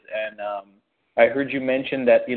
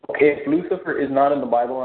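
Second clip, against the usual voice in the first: normal talking voice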